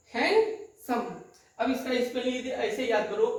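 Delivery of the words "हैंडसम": हैंडसम in two pieces, hand higher, then सम; syllable split. Shown as a demonstration. Teacher demonstrating two syllables, हैंडसम split with a pause. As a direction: break हैंडसम cleanly after hand. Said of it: "the two syllable".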